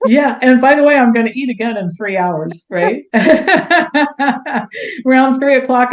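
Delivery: high, loud and laughing; excited laughing. Real, not acted. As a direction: bubble over with laughter.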